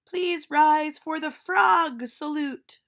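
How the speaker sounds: a teacher proudly calling the class to attention; silly teacher voice. Acted. Teacher announces.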